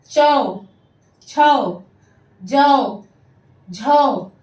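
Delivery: loud, isolated syllables with pauses; teacher modelling sounds emphatically; repeat-after-me drill. Shown as a demonstration. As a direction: call each syllable out for pupils to echo. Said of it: "आप सब मेरे पीछे पीछे बोलिए".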